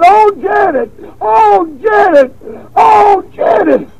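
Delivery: high, slow, crying wail of a lost name; wailing madman. Retold or imitated. Preacher voicing the madman bouncing off the cell wall, high wail. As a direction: cry out the name like a man gone mad. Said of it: "He was saying".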